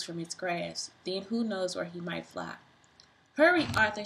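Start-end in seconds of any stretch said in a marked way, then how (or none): 3.4-4.0 urgent call. A narrator's reading. Ada urging Arthur before the storm, urgent. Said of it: "said Ada".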